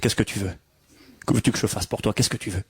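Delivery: gentle question. Retold or imitated. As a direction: ask kindly what he needs. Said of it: "il l'écoute".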